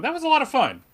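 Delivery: high, loud burst of glee; delighted moviegoer. Retold or imitated. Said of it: "came out of that film going".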